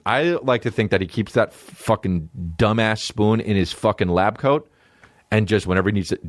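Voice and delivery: usual speaking voice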